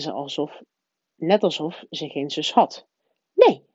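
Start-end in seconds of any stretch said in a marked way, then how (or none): 3.3-3.6 short, peevish character reply; snappish. A narrator's reading. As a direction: cut it off with mild irritation. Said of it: "zei ze nijdig". (kortaf en nijdig)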